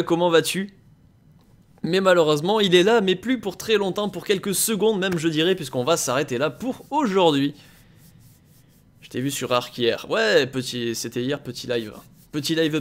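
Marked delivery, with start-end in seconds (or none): none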